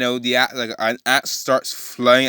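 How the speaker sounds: ordinary talk, nothing marked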